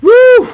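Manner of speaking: loud high whoop; excited woo. Real, not acted. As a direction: let out a big whoop. Hype yell mid-stream.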